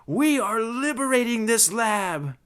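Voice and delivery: high-pitched, forceful declaration; forceful proclamation. Acted. Invader announcing the lab's liberation, proclaiming it forcefully. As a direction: proclaim it like a zealous raider.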